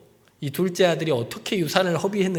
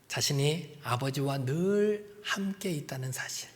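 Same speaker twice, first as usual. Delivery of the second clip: hushed emphasis; 4 semitones lower, 10 dB quieter, slower than usual. Real, not acted. Emphatic quiet point.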